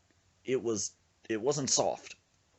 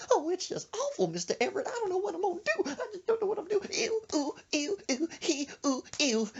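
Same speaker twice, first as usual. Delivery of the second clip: very high, whimpering falsetto; hammed-up distress dissolving into mock sobbing noises; Gomer Pyle. Distraught friend pleads to dad, high whimpering imitation. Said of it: "talking like Gomer Pyle".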